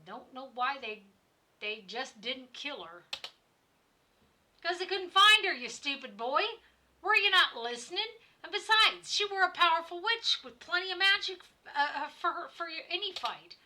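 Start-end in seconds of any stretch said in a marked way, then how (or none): 4.6-13.6 put-on high, loud scolding at the stupid boy; angry shout. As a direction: bark at the boy, fed up. Innkeeper snaps at the boy.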